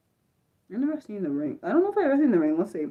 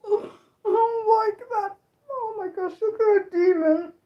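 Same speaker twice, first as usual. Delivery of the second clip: frightened whimper; 11 semitones higher than usual. Real, not acted. Frightened by demon image.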